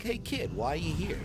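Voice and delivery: high, soft principal voice; lightly voiced curious principal; new principal asks the kid, high and soft. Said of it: "and he's like".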